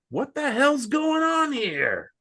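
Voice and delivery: high, slow shouted question; imitating a startled shout; mock shout. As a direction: imitate the film's yell. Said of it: "he's like".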